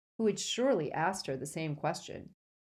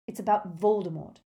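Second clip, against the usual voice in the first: raised, heated, pressing voice; loud angry insistence, pitched up; shouting at her; 4 semitones higher, 5 dB louder than usual. A narrator's reading. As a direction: challenge her loudly. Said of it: "Harry shouted at her".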